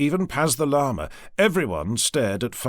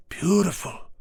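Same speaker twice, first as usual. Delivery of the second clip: hushed rapture; 6 semitones higher than usual. Acted. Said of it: "repeated Orion".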